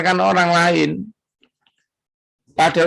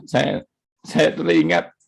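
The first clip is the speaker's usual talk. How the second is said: choked up; 12 semitones lower, 3 dB quieter than usual. Real, not acted. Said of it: "Maaf ya, saudara".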